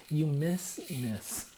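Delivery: slow, deliberate joke line; slow punchline. Pretend. Explaining the [name] pun, slowly.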